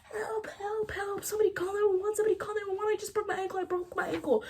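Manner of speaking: high breathy cry for help, fast and hushed; panicked whispered cry. Retold or imitated. Teen with a snapped ankle calls for help, a high, hushed panicked cry. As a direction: whisper a terrified plea. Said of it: "And I start screaming, crying".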